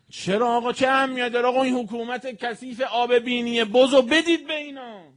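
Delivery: high, loud outraged preaching cry. Acted. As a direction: cry out in indignation at the crowd.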